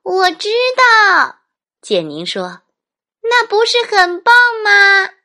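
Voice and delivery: very high, loud, gleeful cry; excited child. Acted. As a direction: squeal with delight.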